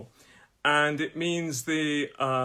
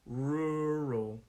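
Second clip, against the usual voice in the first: "rural" stretched long and softer; slowed down; 5 dB quieter than usual. Shown as a demonstration. Teacher modelling the tricky word, slowed down. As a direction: draw "rural" out slowly and softly. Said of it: "Slow it down".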